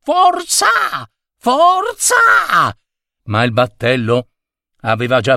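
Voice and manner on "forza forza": high cheering cry of forza; shouting. Acted. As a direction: cheer him on from the deck. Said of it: "i turisti gridavano".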